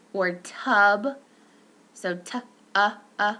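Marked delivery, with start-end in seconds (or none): 0.1-1.2 tub with a clear uh vowel; demo word. Shown as a demonstration. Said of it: "caret, hut".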